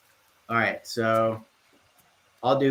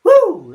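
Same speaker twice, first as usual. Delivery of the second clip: excited whoop; 14 dB louder than usual. Real, not acted. Celebrating after giveaway.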